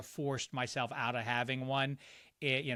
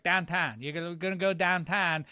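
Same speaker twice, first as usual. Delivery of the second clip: Pittsburgh accent with "Downtown" said quick, as "dahntahn"; 3 semitones higher than usual. Shown as a demonstration. Local explaining the Pittsburgh accent, demonstrating the accent. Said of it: "you do real quick".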